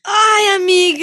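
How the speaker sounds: high, loud shocked squeal; ai amiga shrieked in disbelief; shocked cry. Real, not acted. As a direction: gasp it out, appalled for her friend. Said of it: "reacting to the story".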